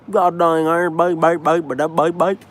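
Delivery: put-on high, loud cranky old neighbor; troll voice. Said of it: "my troll voice is always like".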